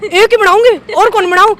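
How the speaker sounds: loud, high imitation of sister yelling; mock shouting. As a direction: shout it out, mimicking an exasperated sister. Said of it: "इतनी जोर जोर से बोले". (बहन की नकल में ज़ोर-ज़ोर से चिल्लाकर)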